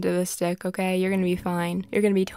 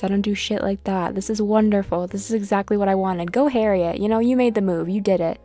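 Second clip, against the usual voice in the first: ordinary delivery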